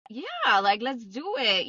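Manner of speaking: high-pitched, drawn-out retold enthusiasm; eager agreement. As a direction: jump at the idea, voice leaping up. Acting out glad agreement to trip.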